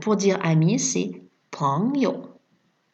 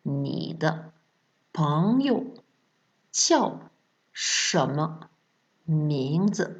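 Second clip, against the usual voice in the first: syllable by syllable; slower than usual. Shown as a demonstration. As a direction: chop the question into pieces with pauses. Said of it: "répétez après moi".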